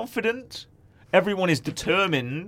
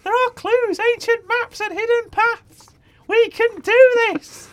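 eager high voice; 4 dB louder than usual. Acted. Penny voice, determined.

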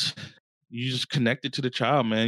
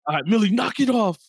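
raised-pitch mock telling-off; retold scolding; 6 semitones higher, 4 dB louder than usual. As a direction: step in to scold a little kid.

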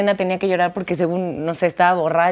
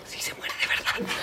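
hushed aside to a castmate; very soft, slow whisper; retold whisper; 14 dB quieter than usual. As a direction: imitate whispering in shock to a colleague. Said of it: "me volteaba con Marlene y yo".

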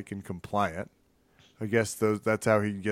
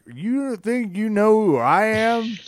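cocky trash talk; 7 semitones higher, slower than usual. Retold or imitated. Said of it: "He's like".

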